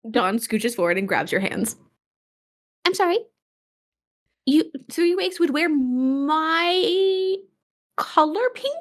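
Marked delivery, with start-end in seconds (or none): 2.9-8.8 slow, rising, astonished; amazed disbelief. Acted. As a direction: stretch it out in wonder. Dawn grabs hands, amazed.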